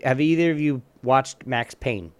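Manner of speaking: ordinary delivery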